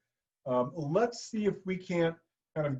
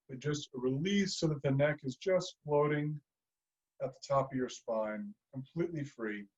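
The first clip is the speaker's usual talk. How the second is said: soft, fading relaxation coaching; calm guided relaxation; 2 semitones lower, 4 dB quieter than usual. Acted. Teacher gently guides a neck release, fading softly. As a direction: ease them into letting go, softer and softer.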